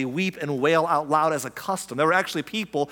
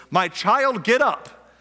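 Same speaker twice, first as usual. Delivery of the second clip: raised, higher-pitched command; loud voice; 6 semitones higher, 5 dB louder, much slower than usual. A narrator's reading. Jesus calls to the dead girl, loud command. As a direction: call it out loudly as scripture's Jesus. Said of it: "said in a loud voice".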